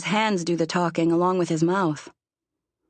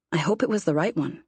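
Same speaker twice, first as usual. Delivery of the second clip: plain reading voice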